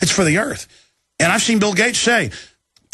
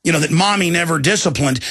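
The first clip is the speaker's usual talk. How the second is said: normal talking voice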